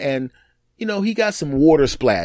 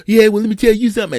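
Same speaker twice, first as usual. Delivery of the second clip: mock snappy wife; 8 semitones higher, 7 dB louder, faster than usual.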